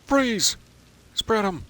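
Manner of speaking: high barked police order; cop shout. Pretend. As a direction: yell it like a cop busting in. Playing cop at a robbery.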